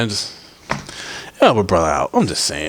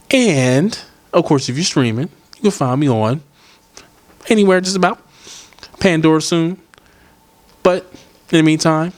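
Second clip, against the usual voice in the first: hyped promo read; 6 semitones higher than usual. Acted. Promo read of where to find the podcast.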